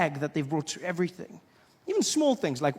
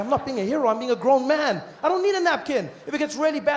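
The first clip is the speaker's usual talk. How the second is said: mock indignant protest; 6 semitones higher than usual. Arguing back, raised voice.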